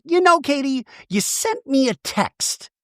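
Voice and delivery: high, slow accusing tone; mock indignant Shannon. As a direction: drag out the accusation. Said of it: "Shannon goes".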